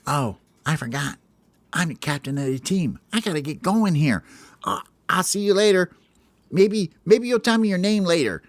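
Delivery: flustered captain excusing himself; Hope remembering. Acted. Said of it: "Hope goes".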